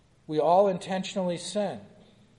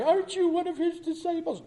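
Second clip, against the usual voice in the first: put-on much higher girlish accusing voice; little girl's question; 12 semitones higher, faster than usual. Servant girl challenging Peter in the courtyard, high accusing voice. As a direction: play the little servant girl pointing him out.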